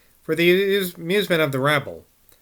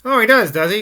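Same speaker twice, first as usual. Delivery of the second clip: loud, higher mock-fierce growl; 7 semitones higher, 7 dB louder than usual. A narrator's reading. Old man mocking the child's demand, growling. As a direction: growl it like a grumpy ogre. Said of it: "growled old Twig".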